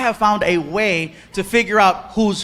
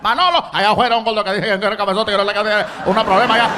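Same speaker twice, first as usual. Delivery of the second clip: fast trash talk; much faster than usual. Retold or imitated. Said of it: "starts talking trash".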